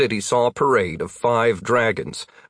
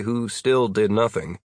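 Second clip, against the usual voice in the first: ordinary delivery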